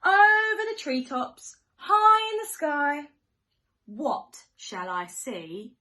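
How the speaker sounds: ordinary delivery, nothing marked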